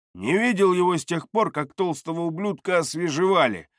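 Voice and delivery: high-pitched defiant answer; agitated retort. A narrator's reading. Бардак answers the last question, high agitated retort.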